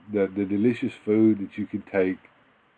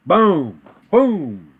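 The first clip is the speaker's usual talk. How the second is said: boom; 6 semitones higher, 7 dB louder than usual. Real, not acted. Excited exclamation after setup.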